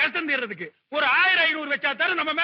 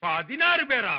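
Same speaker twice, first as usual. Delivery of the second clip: ordinary talk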